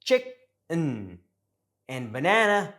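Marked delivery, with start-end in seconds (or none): 0.0-1.2 "chick-n" split, weight on "chick"; "chick-n", stressed first syllable then lazy "un"; syllable stress demo. Shown as a demonstration. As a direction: say "chick-n" in two parts, reduced "n" ending. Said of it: "always gonna stress one syllable".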